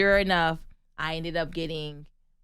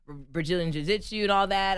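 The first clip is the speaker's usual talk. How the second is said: usual speaking voice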